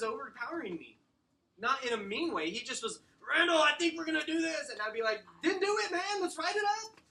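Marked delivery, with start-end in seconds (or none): 3.2-4.7 booming, high-pitched excited shout; loud client. Retold or imitated. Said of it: "He was really loud". 5.4-7.0 high, hammed-up rallying shout; match a loud client's energy at full volume; getting loud back. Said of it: "I had to get back loud with him".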